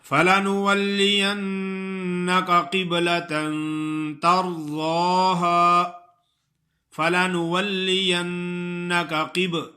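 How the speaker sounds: slow melodic tajweed chant; Quranic recitation. Acted. Recites verse.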